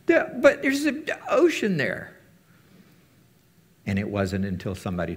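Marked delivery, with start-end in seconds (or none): 0.1-2.1 high, slow whining protest; whiny objection. Pretend. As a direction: whine about the ocean in a squeaky voice. Mocking people's objection.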